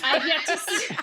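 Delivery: breaking into laughter. Real, not acted. Reader of listener mail breaks into laughter mid-sentence. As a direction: try to read on through real laughter. Reader cracks up, restarts afterwards.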